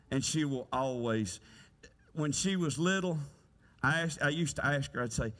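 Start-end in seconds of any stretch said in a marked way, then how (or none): none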